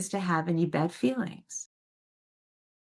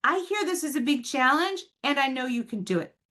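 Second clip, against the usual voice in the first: higher, confident encouragement; believing in the child; 6 semitones higher than usual. Shown as a demonstration. Parent reassuring child facing a big challenge, encouraging. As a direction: tell them you know they can do it. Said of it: "Believe in them".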